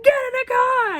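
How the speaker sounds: high-pitched, loud frantic order; comically exaggerated shout; imitated panicked yell. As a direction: shriek it as the fleeing dad. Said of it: "That was hilarious how he said that".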